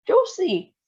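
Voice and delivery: high, loud call; alarmed shout. A narrator's reading. Grandpa shouting to his shrinking wife. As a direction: shout her name in alarm. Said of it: "shouted Grandpa Joe".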